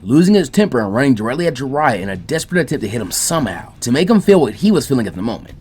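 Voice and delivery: ordinary delivery, nothing marked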